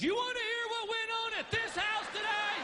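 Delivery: high, shrill-pitched mock wife screech; hammed-up wife yelling back at him; wife screams. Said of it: "Your wife screams".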